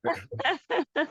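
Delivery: bright high burst of laughter. Real, not acted. Student laughs at face remark.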